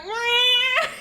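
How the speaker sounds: very high, loud imitated yowl; loud cat scream. Imitating the cat yowling at her face, loud high wail. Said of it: "really loudly, screaming at me".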